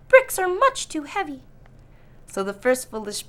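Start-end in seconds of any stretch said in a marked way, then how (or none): 0.1-1.4 scoffing pig. Acted. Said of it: "laughed his brothers".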